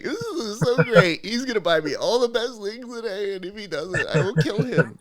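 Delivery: hammed-up delight tipping into a mock threat; imitated gushing wife. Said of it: "she would be in the background being like".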